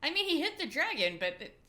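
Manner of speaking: ordinary talk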